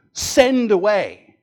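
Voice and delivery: loud, drawn-out burst on "send away"; forceful. Shown as a demonstration. Preacher explaining a Greek verb, loud and forceful.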